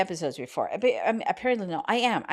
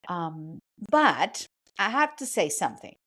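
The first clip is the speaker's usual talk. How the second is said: ordinary delivery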